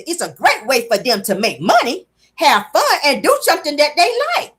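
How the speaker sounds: stilted recital with a lifted finish; teleprompter reading. Retold or imitated. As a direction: imitate someone reading off a prompter. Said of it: "sound like Tisha be reading".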